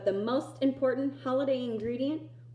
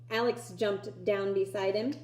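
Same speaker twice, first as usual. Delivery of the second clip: ordinary delivery, nothing marked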